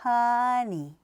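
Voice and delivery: 허니 without staccato; 허니 in two smooth beats; smooth honey. Shown as a demonstration. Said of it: "스타카토가 아니라".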